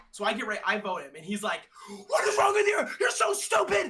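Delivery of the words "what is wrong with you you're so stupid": imitating Ray's high screaming tantrum; mock screaming. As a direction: shriek it like a raging streamer. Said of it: "He starts crashing".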